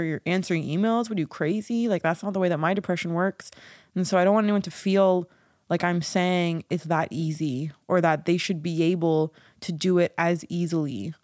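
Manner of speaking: ordinary talk, nothing marked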